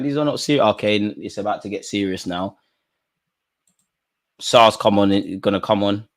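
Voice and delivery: ordinary talk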